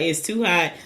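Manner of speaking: ordinary talk, nothing marked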